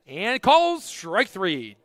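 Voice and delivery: loud, stretched-out play-by-play call; big drawn-out strike three call; excited strikeout call. Real, not acted. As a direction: punch it out and draw out the words. Umpire's strikeout call, live play-by-play.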